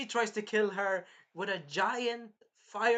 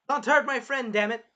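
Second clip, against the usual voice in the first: loud, higher acted warning; mock angry shout; 6 semitones higher, 9 dB louder than usual. Melty attacking to protect her friend, mock angry shout. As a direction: warn them off as the protector.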